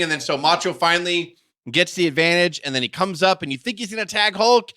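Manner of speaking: ordinary delivery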